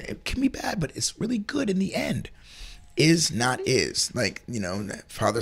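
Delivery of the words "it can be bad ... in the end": soft whisper. Real, not acted. Softening into whisper.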